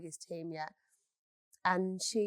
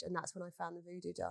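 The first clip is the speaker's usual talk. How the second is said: usual speaking voice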